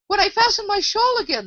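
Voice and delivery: high-pitched woeful moan; groaned out. A narrator's reading. The poor Queen answers Alice, groaning. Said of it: "the poor Queen groaned out".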